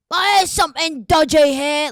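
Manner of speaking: a hammed-up British thug accent; mock northern British accent. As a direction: put on a gruff northern English voice. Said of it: "They sound like".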